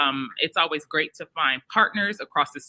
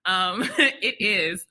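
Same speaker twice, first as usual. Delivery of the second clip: usual speaking voice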